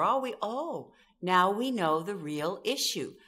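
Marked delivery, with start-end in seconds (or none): none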